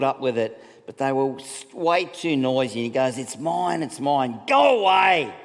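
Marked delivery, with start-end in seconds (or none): 3.2-5.3 lightly voiced giant shouting; a hint of an angry giant; booming giant voice. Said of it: "And that big booming giant voice".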